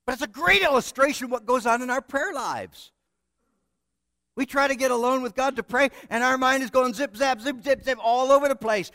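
ordinary talk, nothing marked

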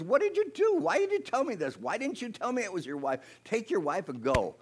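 high-pitched incredulous questioning; indignant king. Acted. King confronting husband over hidden wife, indignant questions. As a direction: jump up high, then ease down.

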